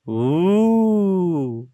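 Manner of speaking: high, hooting ghost moan; a ghost's hollow ooh; spooky ghost. A narrator's reading. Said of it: "You sound really scary".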